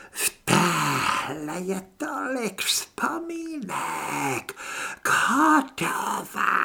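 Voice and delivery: slow, high, whispery monster rasp; creaking creature. A narrator's reading. Parasite sniffing its prey, hungry creaking drawl. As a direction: savour it hungrily, drawing out the words. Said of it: "Hlas skřípal, nebyl na rozhovory zvyklý".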